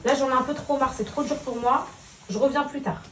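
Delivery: calm him so he listens; gentle mother talk. Real, not acted. Mother talks softly to her son about school.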